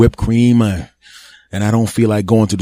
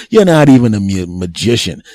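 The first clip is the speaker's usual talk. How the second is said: ordinary delivery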